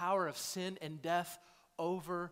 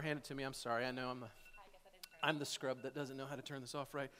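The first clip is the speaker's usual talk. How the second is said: aside; 6 semitones lower, 10 dB quieter, faster than usual. Real, not acted. Fumbling with equipment aside.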